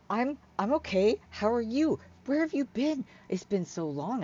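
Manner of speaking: high, eager questions; eager Hyla. Acted. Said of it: "She's like".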